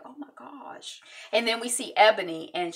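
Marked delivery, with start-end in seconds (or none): 0.0-1.0 hushed gasp. Retold or imitated. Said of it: "we're like".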